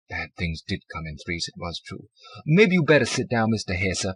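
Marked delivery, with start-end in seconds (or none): 2.4-4.1 clerk voice. A narrator's reading.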